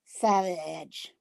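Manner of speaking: usual speaking voice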